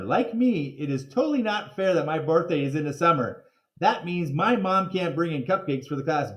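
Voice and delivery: bright storytelling read-aloud; reading to children. A narrator's reading. Teacher reading a chapter to Oliver, lively storybook voice. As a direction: read the chapter like a kids' book.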